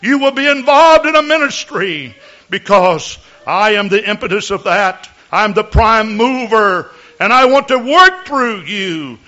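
solemn prophecy in the voice of God; mock biblical prophet. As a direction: speak as the Lord, lofty and grave. Prophecy in God's voice.

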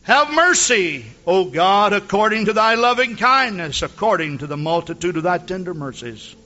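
measured King James recital; scripture reading. Acted. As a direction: read it as a plea to God. Reads Psalm fifty-one aloud.

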